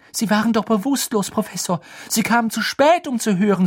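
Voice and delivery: high, insistent argument; pressing, high-pitched protest; urgent objection. A narrator's reading. Girl argues back at the professor.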